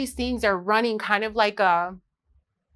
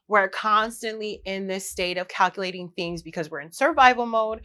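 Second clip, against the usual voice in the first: ordinary talk, nothing marked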